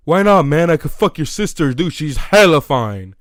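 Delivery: loud, pushy imitation of a drunk friend; acted-out obnoxious bragging; mock brash insistence. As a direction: shout it like a shameless buddy, dragging out the end. Retold drunk friend brashly pushing.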